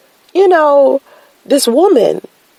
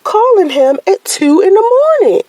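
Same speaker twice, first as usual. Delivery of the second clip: high, indignant inner voice; mock outrage at a late-night caller; rising suspicion, pitched up high; incredulous; 9 semitones higher than usual. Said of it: "I'm like".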